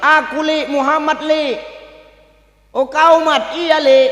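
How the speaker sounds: acted hollered back-and-forth; calling through a gate. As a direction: shout back to an old acquaintance. Shouting to each other through gate.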